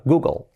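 Google as "GOO-gol"; light L colouring the last vowel of Google; English Google, not "GU-guel"; vowel-changing L. Shown as a demonstration. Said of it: "nos cambia la vocal".

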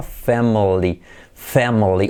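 slow family with a tongue-tip L; English middle L. Shown as a demonstration. Teacher shows the middle L in family, slowly. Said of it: "veis que toca la punta".

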